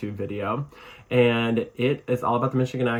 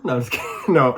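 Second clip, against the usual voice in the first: usual speaking voice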